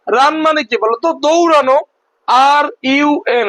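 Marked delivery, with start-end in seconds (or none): 2.3-3.5 slow letter-by-letter spelling; spelled out letters. Shown as a demonstration. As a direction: spell it out slowly. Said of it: "ভেঙে ভেঙে উচ্চারণ". (ধীরে ধীরে বানান করে)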